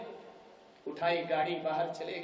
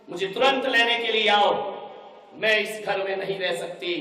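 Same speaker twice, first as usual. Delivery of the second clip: loud demand; 3 semitones higher, 6 dB louder than usual. Acted. Bride threatens father to take her home, loud and insistent. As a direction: shout for father to come at once.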